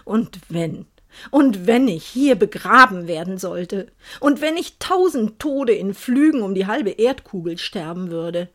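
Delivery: plain reading voice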